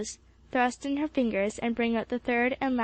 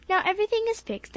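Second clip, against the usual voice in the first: much higher and fast, eager; enthusiastic; 10 semitones higher, faster than usual. A narrator's reading. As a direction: rush it out full of cheer. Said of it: "he added enthusiastically".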